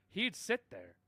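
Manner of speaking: ordinary talk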